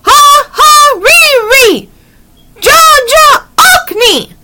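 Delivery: high, forceful bird-like incantation; croaking spell call. A narrator's reading. Annie as a raven works a spell with the twig, high croaking chant. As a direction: call out each magic syllable high and drawn out. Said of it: "she made a deep croaking sound".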